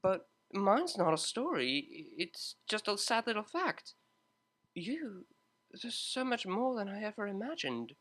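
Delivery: earnest Octavia. Acted. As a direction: pour it out, almost overwhelmed.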